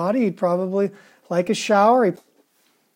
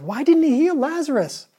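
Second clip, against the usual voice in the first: "didn't" raised high, pleading; higher pleading repeat; 8 semitones higher than usual. Retold or imitated. Preacher repeating the doubters' question, higher and pleading.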